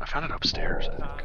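whispering. Real, not acted. Whispering while hiding.

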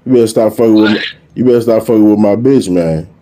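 normal talking voice

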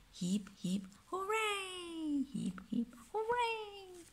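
hooray jumps very high; cheering hooray. Pretend. Parent cheering the brave crew with the child, hooray very high.

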